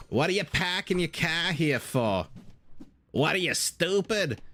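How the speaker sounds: hammed-up Boston accent, pitched high; mock Boston yelling with dropped r's, no real volume. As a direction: imitate a Bostonian tearing into someone. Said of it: "yelling into one another".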